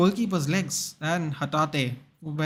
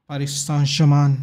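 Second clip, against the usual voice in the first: loud, hammed-up French accent; a jokey French accent he admits is terrible; 9 dB louder than usual. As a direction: belt it out in an over-the-top French accent. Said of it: "Do you like my French accent?".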